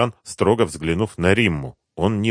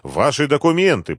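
raised, higher-pitched official demand; требовательно; 7 semitones higher, 3 dB louder than usual. A narrator's reading. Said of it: "потребовал капитан". (требовательный окрик капитана)

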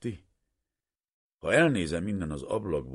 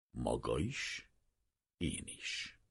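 slow, soft, fading to a whisper; hushed; 4 semitones lower, 8 dB quieter, much slower than usual. A narrator's reading. Professor tells his assistant both must forget, hushed whisper. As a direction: breathe it out quietly.

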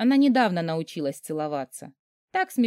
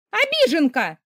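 higher, louder shrieked taunt; a younger sister screaming at her mother; hysterical shout; 8 semitones higher, 5 dB louder than usual. A narrator's reading. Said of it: "истерично закричала моя маленькая сестрёнка".

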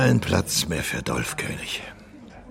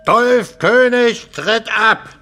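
much higher, louder, slow pronouncement; grand declaration; 12 semitones higher, 5 dB louder, slower than usual. Acted. Old singer announcing his exit, loud and slow. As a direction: proclaim your own end theatrically.

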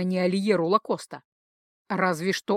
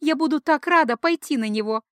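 chirping, lightly voiced; 5 semitones higher, 4 dB louder than usual. A narrator's reading. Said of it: "прощебетала Ския".

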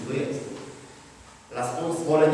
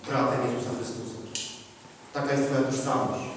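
ordinary delivery

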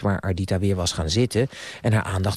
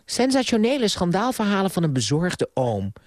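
higher-pitched, mildly yawning dismissal; yawning boredom; 7 semitones higher than usual. A narrator's reading. Niece dismissing her uncle's gossip, yawning boredom. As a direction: wave it away with a touch of yawn. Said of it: "zei Ardita met een geeuw".

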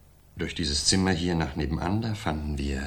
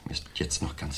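shaken whisper; 3 semitones lower, 10 dB quieter than usual. Acted. Shaken witness recalls finding body.

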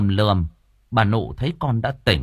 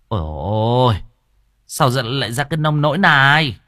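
loud, raised cry of alarm; panicked; 3 semitones higher, 5 dB louder than usual. A narrator's reading. Visitor seeing the son tied up, crying out in alarm. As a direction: exclaim in shocked dismay. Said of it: "Cô An hốt hoảng".

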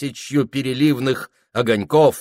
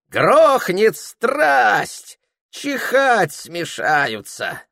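put-on high, slow, boastful old soldier; old gunner voice; 11 semitones higher, slower than usual. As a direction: relish every word like an old cannoneer.

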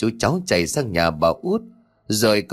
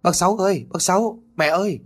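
high, loud searching call; 10 semitones higher than usual. A narrator's reading. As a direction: shout for the neighbour while searching. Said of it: "Vừa tìm tôi vừa gọi to". (gọi to, cao giọng)

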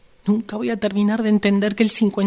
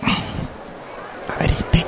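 slow whisper to herself; 4 dB quieter, much slower than usual. Acted. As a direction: breathe it out to yourself as you look. Customer checking the pants. (susurro lento para sí misma)

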